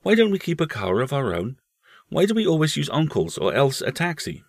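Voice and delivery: put-on curious little boy, high voice; young boy. A narrator's reading. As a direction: lift the voice into a small boy's. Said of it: "said the boy Paul".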